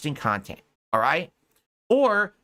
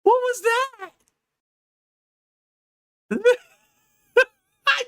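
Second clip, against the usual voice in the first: mocking squeak; 4 dB louder than usual.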